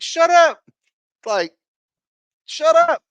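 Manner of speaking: high-pitched, loud mock yell of shut up. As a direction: shriek it to himself in disbelief. Mock yelling at himself.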